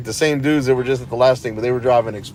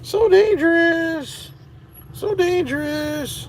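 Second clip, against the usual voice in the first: much higher, slow mocking falsetto; much slower than usual. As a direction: stretch it out in a high silly voice to mock the cops. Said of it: "So dangerous with a camera".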